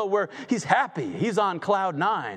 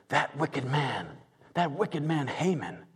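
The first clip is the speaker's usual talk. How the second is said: hushed accusation; 5 semitones lower, 5 dB quieter than usual. Acted. Esther accuses Haman.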